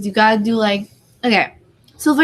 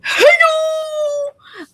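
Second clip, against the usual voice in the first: squealed high and louder hey yo; imitated shout; 3 dB louder than usual. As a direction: mimic friends shouting a greeting. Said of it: "without them going".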